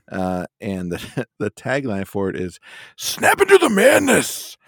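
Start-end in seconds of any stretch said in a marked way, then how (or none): 3.0-4.6 high, loud, slow hype announcer; over-the-top promo voice; announcer shout. Pretend. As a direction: belt it like a Slim Jim ad. Reading the Slim Jim tagline.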